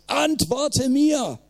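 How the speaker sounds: high, drawn-out desperate cry; anguished high call to God; cry into the night. A narrator's reading. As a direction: plead to the sky, stretched slow. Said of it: "er schreit in die Nacht hinaus".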